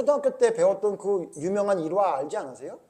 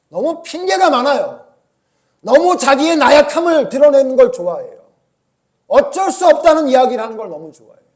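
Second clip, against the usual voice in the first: loud, higher, driving sermon voice; fervent preaching; 8 semitones higher, 13 dB louder than usual. Acted. Preacher scolding the congregation, loud fervent preaching. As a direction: press the point hard to the congregation.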